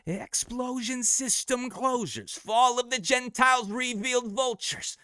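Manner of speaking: like the ears ringing after a flashbang; ringing-ears eerie voice. Shown as a demonstration. Rapper demonstrating the post-flashbang part, very high and slow. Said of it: "you got that ringing all of a sudden coming into your ears".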